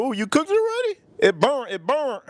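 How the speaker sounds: high mocking voice of a doubter; mock onlooker. Mimicking people who think the meat burnt. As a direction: squeak it out like a clueless critic. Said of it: "people will think you".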